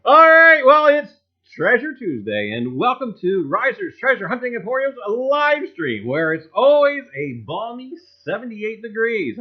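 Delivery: loud, high showman opener; announcer intro. Acted. Host greeting his live stream viewers, loud announcer voice. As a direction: boom out the show's welcome like a host.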